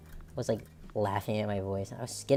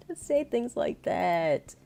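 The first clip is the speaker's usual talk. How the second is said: mock protest; 7 semitones higher, slower than usual. Reacting to a voice compared with [name]'s, mock protest. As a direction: draw it out high, playfully scandalized. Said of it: "how to do [name]'s voice".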